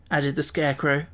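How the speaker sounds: ordinary delivery, nothing marked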